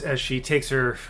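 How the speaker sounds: ordinary delivery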